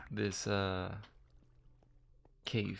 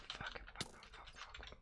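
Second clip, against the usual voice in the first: whispered swearing; 15 dB quieter, slower than usual. Real, not acted. Startled by a skeleton.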